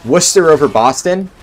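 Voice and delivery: ordinary talk, nothing marked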